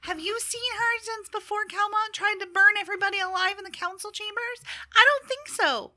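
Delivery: high, argumentative gnome voice; unhappy gnome. Acted. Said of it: "a very unhappy gnomish voice".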